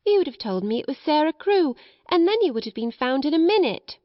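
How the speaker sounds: put-on high-pitched, lively child's voice; young boy, self-reproachful. Boy tells Sarah she'd have been found, rueful.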